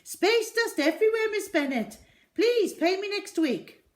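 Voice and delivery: very high, loud hurried fib; a shopkeeper bluffing his customer; high loud nervous brush-off. A narrator's reading. Raj nervous.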